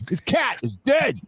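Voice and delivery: high-pitched cartoon mobster; over-the-top gangster threat; mock hitman. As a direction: announce the hit in a hammed-up high voice. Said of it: "trying to put hits on people".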